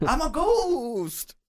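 very slow, high drawn-out ghost wail; ghostly shout. Acted. Player running between the men: long high ghostly wail. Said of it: "So you're shouting that".

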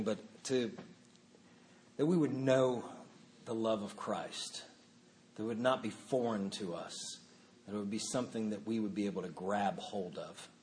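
soft, low, halting prayer. Real, not acted. Pastor prays for the congregation to know Christ's love, prayer. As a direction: pray quietly, feeling for the words.

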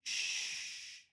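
soft, long "shh"; whispered calming hush; soothing shush. A narrator's reading. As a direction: hush a frightened animal. Soothing a wounded horse.

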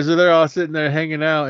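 normal talking voice

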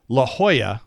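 La Jolla with J as an H sound. Shown as a demonstration. Giving a counterexample, La Jolla said with an H. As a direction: start Jolla on an H. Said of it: "the J making an H sound".

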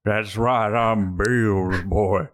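very slow, drawn-out drawl; changed put-on voice. Customer repeating his bluff to the boatman, slow changed voice. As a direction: stretch it out in an altered voice. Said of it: "Why are you changing your voice?".

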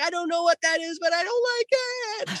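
high, thin voice of the frightened wife; high imitation of wife fleeing; scared wife. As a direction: mimic his wife backing away. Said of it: "She's like".

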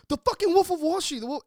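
high, loud, starstruck; excited shout. Retold or imitated. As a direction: blurt it out like a fanboy. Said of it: "And he's like".

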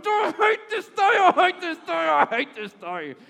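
high, loud shouted I hate this day, slowing at the end; rage-shout it at the steering wheel; a loud high shout of frustration in the car; frustrated shout. Retold or imitated.

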